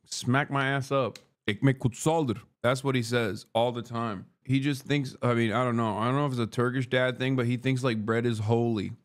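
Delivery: ordinary talk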